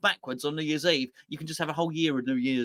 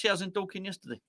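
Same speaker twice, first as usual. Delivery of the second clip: quiet repeat; 7 dB quieter, much faster than usual. Shown as a demonstration. Repeating the Dorking line quietly to chat, understated. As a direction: say it again, dropped and understated. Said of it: "There you go".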